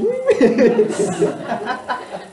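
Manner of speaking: bright high laugh; high amused burst. Real, not acted. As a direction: laugh with relief. Laughs at the joke.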